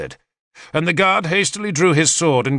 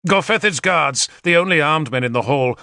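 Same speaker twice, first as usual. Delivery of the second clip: ordinary delivery, nothing marked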